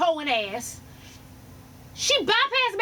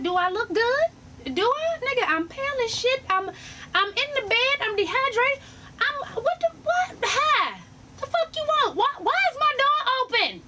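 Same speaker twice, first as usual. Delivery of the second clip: much higher, sassy and outraged; indignant rant; 7 semitones higher than usual. Retold or imitated. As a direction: fire it back, offended and high. Annoyed at men passing by.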